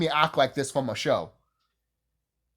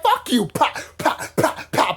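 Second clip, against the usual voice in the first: higher, louder "pa, pa" punch sounds; playful mock fight with punch noises; 9 semitones higher, 4 dB louder than usual. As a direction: act out a fistfight with your mouth.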